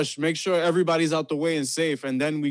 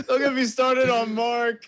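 laughing; 9 semitones higher than usual. Retold or imitated.

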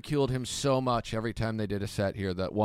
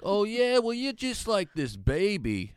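imitating a sneering rival, higher and slowed; hammed-up taunt from a petty comic; mocking rival; 10 semitones higher, slower than usual. Comic mimicking his rival's taunt, higher, slow.

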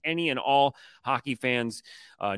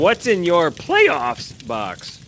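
slow drawn-out question; 6 semitones higher, 8 dB louder, much slower than usual. Acted. Slow mock host question.

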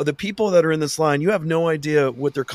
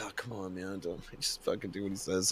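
very soft low pleading cop; soft old cop; 5 semitones lower, 16 dB quieter than usual. Retold or imitated. The sweet older cop to the traveler, soft and low. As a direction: murmur it like a kind old cop.

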